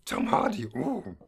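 breathy Yoda whisper of the name; hushed Yoda voice. Pretend. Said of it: "you say Tom Hardy with your British accent as Yoda".

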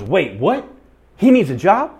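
high, loud burst of disbelief and eagerness; retold excited outburst. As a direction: jump on the news, loud and high. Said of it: "she got all excited".

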